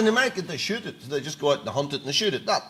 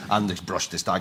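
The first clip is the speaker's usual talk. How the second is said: ordinary delivery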